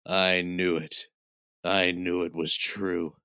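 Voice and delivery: low, slow hushed awe building to a shout; mock Brock. Wrestler discovering Wales, low and slow into a shout. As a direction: intone it deep and slow, then bellow the name. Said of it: "and he goes".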